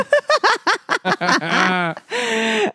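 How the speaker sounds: high peal of laughter; long, real burst of laughing. Real, not acted. Laughing at the job email.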